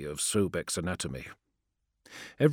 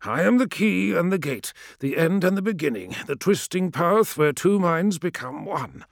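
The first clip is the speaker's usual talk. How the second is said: higher, smooth and flowing; 9 semitones higher, 3 dB louder than usual. A narrator's reading. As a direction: let the words flow like water. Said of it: "his voice like clear water".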